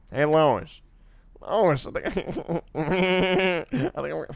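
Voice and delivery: a hint of Peter Griffin, fading out; Peter Griffin impression. Streamer attempting Peter Griffin for chat, faltering mild impression. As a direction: try Peter's voice and lose it. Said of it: "the Seth MacFarlane pose".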